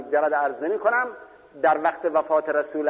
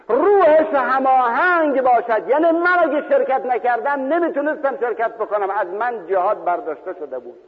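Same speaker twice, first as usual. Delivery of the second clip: fervent preaching; 9 semitones higher, 4 dB louder than usual. Retold or imitated. Jabir explains spiritual union.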